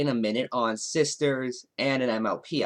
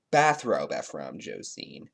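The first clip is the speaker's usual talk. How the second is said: much lower, grand self-announcement; pompous self-introduction; 12 semitones lower, 4 dB quieter than usual. Acted. Bathrobe persona introduces himself, mildly pompous. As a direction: announce yourself like a lofty intellectual.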